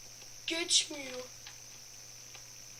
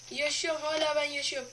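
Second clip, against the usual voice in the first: ordinary talk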